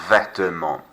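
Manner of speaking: Vêtements, no T and no final S; Vêtements as vet-mon, nasal ending; French pronunciation. Shown as a demonstration. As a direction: model Vêtements for the class. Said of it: "Silent T, silent S".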